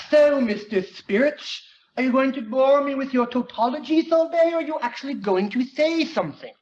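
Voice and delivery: lightly voiced genius kid, bored and pushy; a smug teen demanding Junior get to the point; impatient Gordy.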